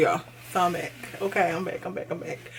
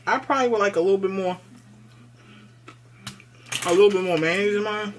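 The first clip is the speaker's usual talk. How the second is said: mouth full. Real, not acted. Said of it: "Excuse me for talking with my mouth full".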